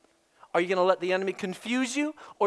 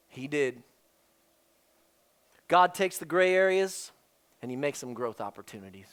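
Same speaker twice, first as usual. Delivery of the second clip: much softer, lower and earnest; pastoral appeal; 9 semitones lower, 6 dB quieter than usual. Acted. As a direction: plead gently with the church.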